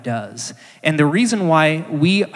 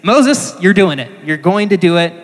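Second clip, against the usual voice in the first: sharp raised voice, a mock scolding; angry God; 4 semitones higher, 6 dB louder than usual. God angrily insisting Moses will do it, raised voice. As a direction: bark his name high and loud, then press on. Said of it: "And God gets angry at him".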